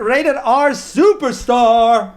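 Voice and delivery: high, drawn-out ring announcer call; ring announcer imitation. Wrestling fan excitedly imitates a superstar's introduction, ring announcer style. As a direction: stretch it out like a wrestler's big introduction. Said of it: "that ring announcer".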